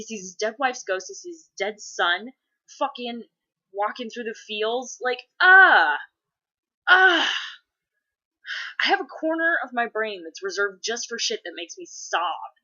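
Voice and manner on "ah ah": high, loud mock-agonized "ah"; hammed-up cry of heartbreak; big falling wail of overwhelmed grief; loud anguished wail. Imitates sobbing reaction to sad film.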